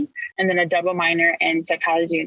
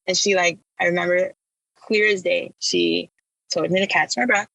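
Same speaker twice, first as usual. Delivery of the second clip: normal talking voice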